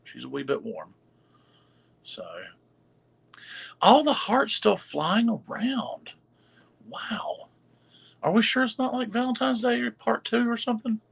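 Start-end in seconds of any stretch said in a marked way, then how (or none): 3.8-11.0 excited. Real, not acted. Delighted by hearts in chat.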